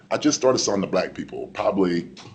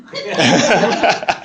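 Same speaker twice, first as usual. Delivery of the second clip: loud high laugh; burst of laughter; 10 semitones higher, 4 dB louder than usual. Real, not acted. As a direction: cracks up laughing. Laughs at his own story.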